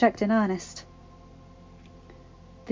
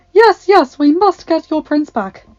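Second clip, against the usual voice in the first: put-on high, loud, insistent old woman; earnest; 7 semitones higher, 10 dB louder than usual. A narrator's reading. Agatha pats Belle's cheek, promising earnestly. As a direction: urge it eagerly, full voice. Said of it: "she said earnestly".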